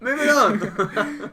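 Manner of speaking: high, loud, slow and giggly; real laughter in the words; laughing moving on. Real, not acted. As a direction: push on while still laughing.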